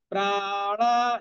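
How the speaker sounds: "প্রাণা" with both vowels drawn out slowly; both vowels long. Shown as a demonstration. Teacher explaining long vowels, "প্রাণা" slowly drawn out. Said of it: "দুটোই দীর্ঘ হবে".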